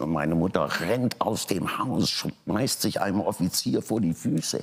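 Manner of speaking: ordinary delivery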